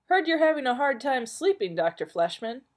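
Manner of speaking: high, loud friendly greeting; breezy barfly hello; Ed cheerful. Acted. Barfly greeting the sleepless doctor, bright and high.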